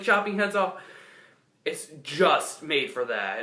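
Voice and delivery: normal talking voice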